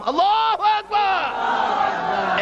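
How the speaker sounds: high, long-drawn takbir cry; takbir shout. Real, not acted. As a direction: shout it out, stretching each word. Leads the crowd in takbir. (টেনে তাকবিরের ধ্বনি)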